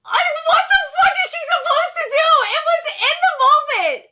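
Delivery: high-pitched, laughing exasperation; exasperated outburst. Real, not acted. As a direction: burst out in a fan's indignant defence. Exasperated defence of a character.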